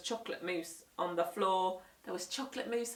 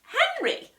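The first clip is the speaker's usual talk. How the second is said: much higher, louder mum's warning; 9 dB louder than usual. Acted. Mum warning naughty Henry, sharp high call. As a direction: stop him with his name. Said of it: "said Mum".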